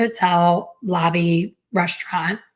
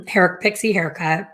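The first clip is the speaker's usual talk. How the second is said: ordinary delivery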